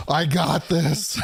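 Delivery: slow, high, laughing through it; mock heroic resolve. Hero in the Mexico skit, laughing mock confidence. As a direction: grin through a hammed-up I got this.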